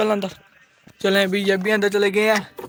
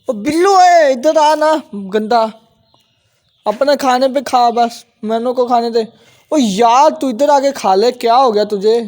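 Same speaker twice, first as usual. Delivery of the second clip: loud scolding call; 3 semitones higher, 7 dB louder, slower than usual. Real, not acted. Owner calling Billu off Mano's food, loud exasperated scolding.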